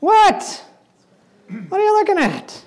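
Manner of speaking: high, defensive challenge; acted touchy outburst; mock indignation. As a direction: snap back, pitched high and offended. Skit, indignant at being stared at.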